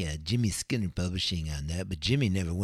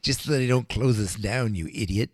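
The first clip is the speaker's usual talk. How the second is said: mock bouncer; 5 semitones higher, 4 dB louder than usual. Bouncer answering the kids.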